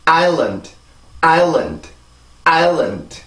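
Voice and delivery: island as EYE-lund, big beat then small beat; English two-syllable rhythm. Shown as a demonstration. Teacher modelling for repetition, island as two-beat EYE-lund. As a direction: stress island's first vowel, end on a bare D. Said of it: "이렇게 해서 이 단어가 발음이 돼요".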